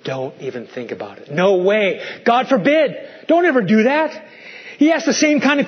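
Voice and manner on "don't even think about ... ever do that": each phrase hit with rising force; with emphasis. Shown as a demonstration. Preacher forbidding sin to believers, rising emphasis. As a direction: build it up, no way, God forbid. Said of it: "with emphasis".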